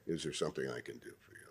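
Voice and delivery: low, quiet and quick, barely looking up; bored booth clerk. Shown as a demonstration. Clerk in the booth brushes off the waiting visitor, bored and low. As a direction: toss it off like a clerk checking the watch. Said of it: "until they look up and say".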